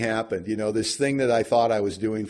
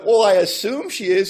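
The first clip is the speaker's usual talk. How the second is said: higher and louder, a touch deflated; sheepish reply; 9 semitones higher, 9 dB louder than usual. Retold or imitated. Answering sponsor about the paint, higher and sheepish. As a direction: answer like you just got caught.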